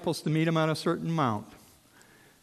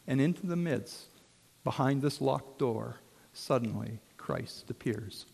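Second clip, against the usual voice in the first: ordinary talk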